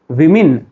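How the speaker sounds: "women" as wi-min; "women" ending in min, falling; correct women. Shown as a demonstration. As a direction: say "women" with i in both syllables. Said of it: "तो आपको कहना है".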